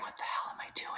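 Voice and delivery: unvoiced whisper to yourself; whispered inner thought. Mother catching herself inspecting baby, whispered. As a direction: hiss the realisation quietly.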